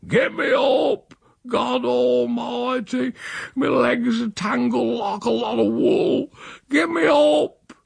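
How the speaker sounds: put-on high, slow drunken wail; holler, dragging out every word; bawling for help. A narrator's reading. Drunk uncle stuck, cursing for help.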